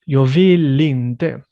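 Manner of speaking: Jag vill inte slow and loud, vill running into inte; linked words. Shown as a demonstration. Teacher modelling linked vill inte for a student, slow and loud. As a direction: run vill straight into inte, slowly. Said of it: "connect the L and the E".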